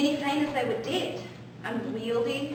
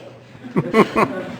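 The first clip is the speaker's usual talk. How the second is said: laughter; 9 semitones lower, 7 dB louder than usual. Acted. Juliet laughs at nurse.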